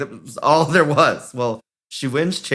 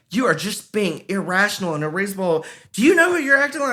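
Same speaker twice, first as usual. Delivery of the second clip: mad mother; 8 semitones higher, faster than usual. Retold or imitated. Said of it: "she was so mad".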